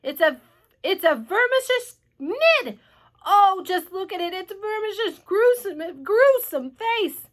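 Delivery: high, loud, halting horror; appalled police chief wailing; horrified cry. A narrator's reading. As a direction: recoil in dread, dragging out the words. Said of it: "cried the chief of police".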